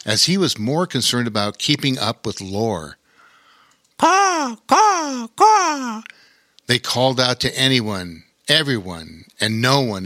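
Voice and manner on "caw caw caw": shrill screeching bird woman. Acted. Crows calling overhead.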